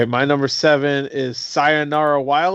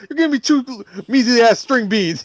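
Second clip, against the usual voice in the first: [name] impression; 6 semitones higher than usual. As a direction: snap it out like the angry dad. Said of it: "He's like".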